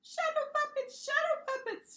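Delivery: very high, squeaky monster voice; tiny monster pitching her idea, squeaking; excited squeak. Acted. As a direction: squeak it twice with glee. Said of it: "she squeaked again".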